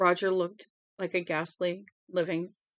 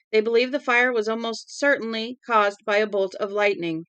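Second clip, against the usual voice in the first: crisp newscaster read, a bit louder; radio news bulletin; 3 semitones higher, 5 dB louder than usual. Acted. Broadcaster on the radio announcing the deadly fire, news read. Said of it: "The broadcaster said".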